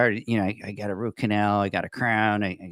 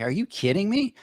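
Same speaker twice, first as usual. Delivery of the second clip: higher, incredulous are you kidding me; freaking out; 10 semitones higher than usual. Retold or imitated. Recounting the orthodontist's long wait to his co-host, mock panic. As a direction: retell the panic with a raised pitch. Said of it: "I'm like, I'm freaking out".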